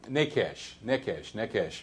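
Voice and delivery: "nekesh" said slowly, stress on the first syllable, falling; "nekesh" repeated, the first syllable stressed; Hebrew word. Shown as a demonstration. Preacher teaching the Hebrew "nekesh", stress on the first syllable. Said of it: "the Hebrew word for snake".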